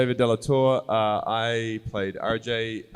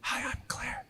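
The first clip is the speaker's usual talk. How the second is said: hushed greeting; 13 dB quieter than usual. Retold or imitated. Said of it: "she said".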